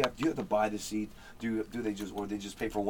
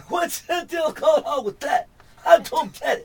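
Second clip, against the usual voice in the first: much higher, louder, slow shouting Chris Rock; 10 dB louder, slower than usual. Shown as a demonstration. Showing the guys his Chris Rock, slow shouting impression. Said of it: "You do Chris Rock?".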